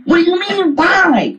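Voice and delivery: loud, high-pitched disbelief; incredulous. Real, not acted. Debater answers opponent's "But why?", incredulous. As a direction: throw the question back, high and exasperated.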